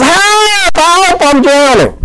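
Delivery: a preacher acting out Peter sinking, high mock yell; retold yell for help. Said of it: "Then he yells".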